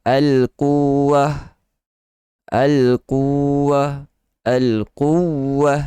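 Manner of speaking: al-quwwah read with a light, unemphasized w; weak, unstressed w. Shown as a demonstration. Teacher showing the wrong reading, al-quwwah light. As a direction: say al-quwwah without pressing the shadda. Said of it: "Dia tidak baca dengan".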